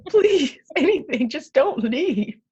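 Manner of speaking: loud, high frantic pleading; desperate begging. Pretend. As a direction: beg him not to leave, frantic, ending slow. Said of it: "begging dad to stay".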